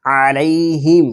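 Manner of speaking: غیر المغضوب علیہم chanted slowly, long waw; teacher's slow tajweed chant; slow Quran recitation. Shown as a demonstration. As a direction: model the phrase with the madd held. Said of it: "اس لیے ہم نے اس کو لمبا کیا ہے".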